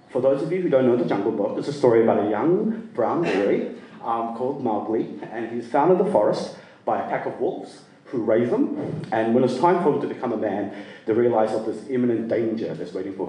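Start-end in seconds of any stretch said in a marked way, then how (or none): none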